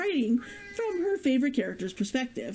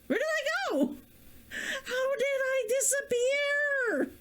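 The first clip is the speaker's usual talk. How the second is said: much higher, slower, baffled squeak; bewildered, voice shooting up high; startled confusion; 12 semitones higher than usual. Real, not acted. Streamer suddenly vanishing from frame, baffled and high-pitched.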